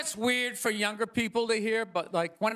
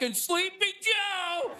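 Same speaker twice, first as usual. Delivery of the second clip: drawn-out, raised mock Trump taunt; Trump mid-dodge; 6 semitones higher, slower than usual. Trump taunting as the bullet flies, slow and high. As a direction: stretch the nickname out slowly like a jab. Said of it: "Trump was just like".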